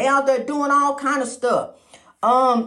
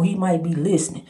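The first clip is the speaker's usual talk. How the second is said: much lower, hushed-down voice; toned down; 8 semitones lower than usual. Real, not acted. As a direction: bring it way down low. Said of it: "I'm toning it down".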